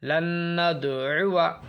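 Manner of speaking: drawn-out recitation, alif left silent; slow tajweed recitation; Quranic recitation. Shown as a demonstration. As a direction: recite it slowly with the alif unspoken. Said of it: "यहाँ भी आप समझ लीजिए".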